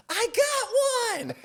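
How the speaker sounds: imitating Brian, squeaky high and slow; a huge high yelp of triumph; high pitched excited voice. Friend showing off his catch, high-pitched mock cry. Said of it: "I love the high pitched voice".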